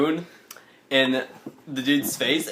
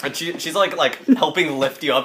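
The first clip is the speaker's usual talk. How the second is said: ordinary delivery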